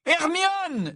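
very high alarmed shout; cry out. Acted. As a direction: shout her name in alarm. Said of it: "s'écrièrent Harry et Ron d'une même voix".

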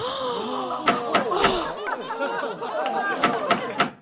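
shocked gasp. Acted. Courtroom reacts to Lupin's name.

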